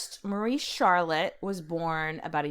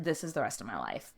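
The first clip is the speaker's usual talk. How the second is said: much lower and softer, a defeated statement; mock despair; 9 semitones lower, 7 dB quieter than usual. Shown as a demonstration. Young wife resigned to her marriage, quiet despair. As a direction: let it fall, quiet and hopeless. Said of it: "And she's like".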